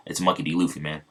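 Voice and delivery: usual speaking voice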